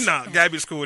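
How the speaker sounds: usual speaking voice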